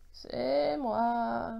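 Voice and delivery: drawn-out, croaky groan; hoarse moan. A narrator's reading. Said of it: "a gémi la voix enrouée".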